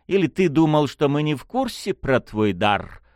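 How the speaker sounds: high-pitched, teasing needle; mocking drawl. A narrator's reading. Unseen lady taunts the prince about his gift, mocking. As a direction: taunt him from the shadows. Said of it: "насмешливо протянул голос".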